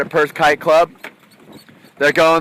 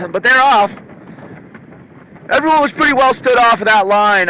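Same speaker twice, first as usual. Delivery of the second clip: excited; 6 semitones higher than usual. Real, not acted. Announcing the race start.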